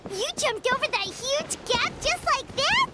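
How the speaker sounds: usual speaking voice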